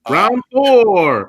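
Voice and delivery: loud, high arcade announcer call; booming fighting game announcer. Pretend. Calling the round, game style.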